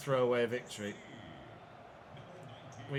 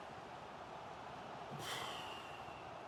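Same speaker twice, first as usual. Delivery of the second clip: long sigh; 16 dB quieter than usual. Real, not acted. Easy goal thrown away.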